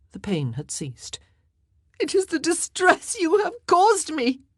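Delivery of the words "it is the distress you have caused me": high, loud accusing cry. A narrator's reading. Wife blames husband for her pain, crying out. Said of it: "she cried".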